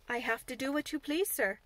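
ordinary delivery, nothing marked

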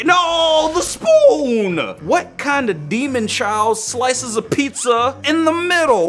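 high, loud dismayed yelp; shocked outcry. Real, not acted. Watching a spoon vanish into soup, high shocked yelp. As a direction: cry out as the spoon sinks away.

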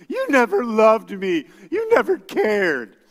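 put-on loud, high, slow teen accusation; mock teenager yelling at dad; accusing teenager. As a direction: hurl it at dad like a hurt teen. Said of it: "they're gonna go".

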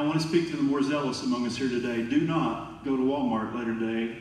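normal talking voice

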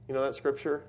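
ordinary delivery

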